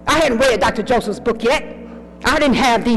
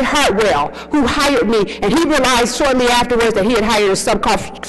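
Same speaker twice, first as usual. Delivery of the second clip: usual speaking voice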